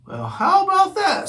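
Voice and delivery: surprised mail driver. Acted. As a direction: marvel at the sight of the kitten. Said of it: "said the driver".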